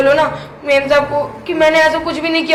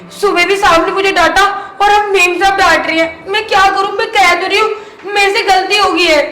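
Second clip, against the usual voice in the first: higher and louder, whining to the elder; whiny complaint; 7 semitones higher, 4 dB louder than usual. Acted. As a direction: plead your case like a wronged maid. Maid complains to mistress about scolding. (ऊँची आवाज़ में रोना-धोना करती शिकायत)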